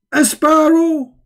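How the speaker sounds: high, slow mocking squeal on a sparrow; sneering high-pitched sparrow. Real, not acted. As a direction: squeal the words in ridicule.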